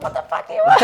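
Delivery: very high, squawked mom impression; comic high-pitched yell of irritation; mock annoyed mom. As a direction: imitate an exasperated mom, laughing. Voices mom answering the door.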